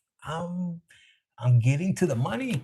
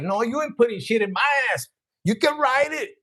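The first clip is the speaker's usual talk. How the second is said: mock shout; 6 semitones higher than usual. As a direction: throw it back at him with a laugh. Lover's retorted refusal, shouted.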